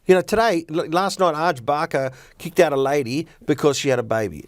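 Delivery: ordinary talk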